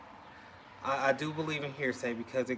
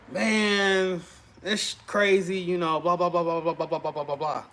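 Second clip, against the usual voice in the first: mildly brusque, a bit raised; a touch aggressive; 4 semitones higher than usual. Retold or imitated. Husband answering his wife after a bad day, mildly brusque. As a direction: brush her off a little sharply. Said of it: "my voice is more aggressive than normal".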